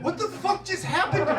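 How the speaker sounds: high, laughing disbelief; baffled. Retold or imitated. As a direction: ask it stunned and giggling. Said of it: "I was like".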